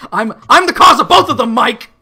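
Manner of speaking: loud, high excited yell; shouting. Real, not acted. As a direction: yell it out to Mike.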